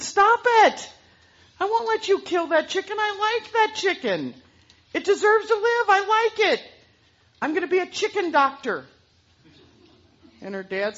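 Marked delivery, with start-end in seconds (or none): 0.0-8.9 put-on high, loud little girl pleading. Girl begging her dad to spare the chicken, high and loud. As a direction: beg for the chicken's life as a girl.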